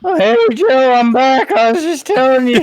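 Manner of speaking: high, loud wrestling announcer voice; Larry Funk impression. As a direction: ham it up like a wrestling commentator. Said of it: "It is kinda Larry Funkish".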